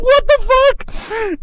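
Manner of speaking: high, slow drawn-out gasp of shock; stunned disbelief. Retold or imitated. Witness of the stick attack, high slow amazed disbelief. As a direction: drag it out, laughing in disbelief. Teller reacts to assault.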